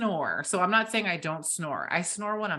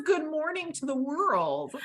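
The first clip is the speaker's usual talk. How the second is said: bright high chirp; 8 semitones higher than usual. Retold or imitated. Imitating partner's cheerful morning.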